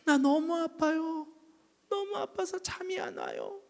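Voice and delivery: high, slow pained voice of the dying husband; sick man complaining. Retold or imitated. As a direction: moan that it hurts. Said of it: "막 그러는 거예요". (아파하는 환자의 목소리)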